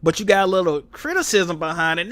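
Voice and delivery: ordinary delivery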